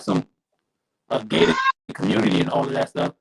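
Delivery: robotic, glitchy mic audio; robot voice through a bad mic. Real, not acted. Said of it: "you sounded like a robot".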